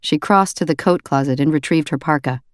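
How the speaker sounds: usual speaking voice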